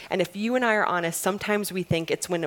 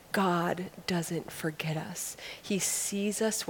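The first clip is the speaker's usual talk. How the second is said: soft whisper; 7 dB quieter, slower than usual. Real, not acted. Tender opening of a sermon.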